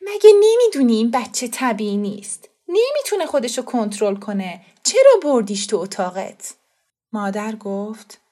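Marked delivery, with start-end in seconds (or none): 0.0-6.5 louder, contemptuous scolding; scornful woman. A narrator's reading. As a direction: sneer at the sick child. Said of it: "فتان خانم با نفرت دهانش را جمع کرد". (لحن زنی پر از نفرت)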